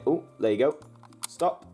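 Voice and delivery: ordinary talk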